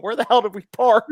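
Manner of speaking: lost dad in the parking lot, fed up; imitating frustrated self; mock exasperation.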